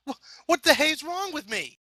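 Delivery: high, panicked stammer; startled shriek. A narrator's reading. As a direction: she can't believe the mirror. Startled at her reflection.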